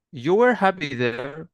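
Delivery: "You were happy there" drawn out slowly, rising at the end; statement as question. Shown as a demonstration. Teacher showing an English question without inversion, slow questioning rise on "You were happy there". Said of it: "you say that as a question".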